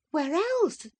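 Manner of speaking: eager Catherine. A narrator's reading. Catherine urges Edgar to befriend Heathcliff, eager and high. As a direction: press him eagerly for his blessing.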